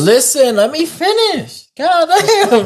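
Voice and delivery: high-pitched, mock-outraged pleading; exasperated protest. Real, not acted. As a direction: demand the floor from friends cutting you off. Protesting interruptions in a friendly argument.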